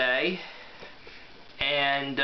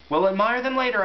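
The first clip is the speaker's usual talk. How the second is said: urgent call; 8 semitones higher, 5 dB louder than usual. Acted. Urging friends to flee.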